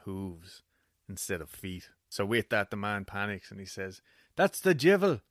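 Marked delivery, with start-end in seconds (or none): 4.3-5.2 loud, high panicked cry; panicked shout. Acted. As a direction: shriek it in sudden terror. Man panics seeing cloven hooves.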